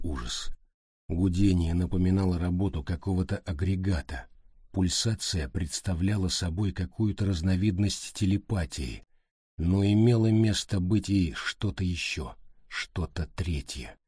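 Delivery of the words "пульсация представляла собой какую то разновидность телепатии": usual speaking voice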